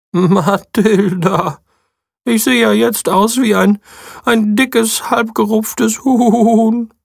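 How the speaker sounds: high, slow, dismayed sheep voice; high sheep voice wailing at its reflection. Acted. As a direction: drag it out in despair.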